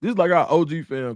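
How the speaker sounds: normal talking voice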